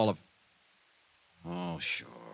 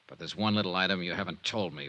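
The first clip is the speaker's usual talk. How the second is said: normal talking voice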